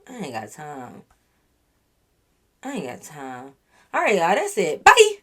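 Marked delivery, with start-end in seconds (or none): 0.1-3.5 much softer and lower, trailing off; fading dismissal. Real, not acted. Vlogger fading out her dismissal of the liar, soft and low. 4.8-5.2 bright, much higher and louder; chirpy bye. Real, not acted. Vlogger playfully waving off the story, high and loud.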